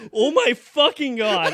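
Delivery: loud, slow, high outburst; mock-horrified mom, loud and drawn out; horrified outcry. Mom looks over at the drive-through, horrified outcry. Mother's horrified reaction, acted.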